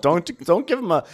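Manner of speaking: exasperated. Real, not acted. Exasperated scolding.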